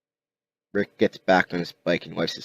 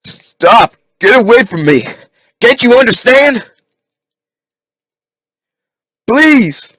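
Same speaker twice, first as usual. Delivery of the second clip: high, loud frantic begging; desperate pleading shout; 13 dB louder than usual. Acted. Rick crawling back from walkers, loud frantic pleading. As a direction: beg them to stop, voice cracking high.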